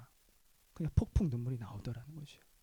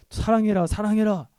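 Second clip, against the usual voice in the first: loud, higher scolding repetition; stern loud command; 6 semitones higher, 10 dB louder than usual. Retold or imitated. God imagined loudly scolding the pastor, stern. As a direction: bark it as a stern rebuke. God's expected rebuke imitated.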